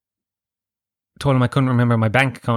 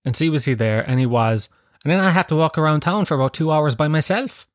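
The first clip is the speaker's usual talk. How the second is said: ordinary talk, nothing marked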